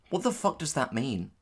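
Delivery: usual speaking voice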